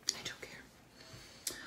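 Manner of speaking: drawn-out faint whisper; slow whisper. Real, not acted. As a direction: whisper it slowly, trailing off. Repeated slower whisper.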